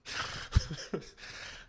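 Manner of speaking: breathy chuckle. Real, not acted. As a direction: laugh it off. Laughing at own joke.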